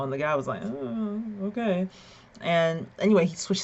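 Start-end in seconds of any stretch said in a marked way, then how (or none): 0.6-1.9 higher, drawn-out giving in; lightly voiced reluctant okay; imitated hesitant agreement. Man agreeing to swap seats, hesitant. Acts the seat-owner's reply.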